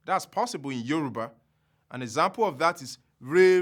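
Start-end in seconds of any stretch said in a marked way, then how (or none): none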